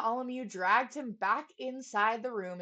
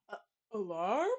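yawned. A narrator's reading. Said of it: "yawned the trumpeter".

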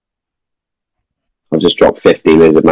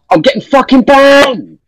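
exasperated; slower than usual. Real, not acted. Lag ruining the raid.

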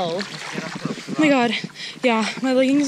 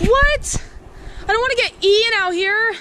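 scared; 11 semitones higher than usual. Real, not acted. Alone, scared in woods.

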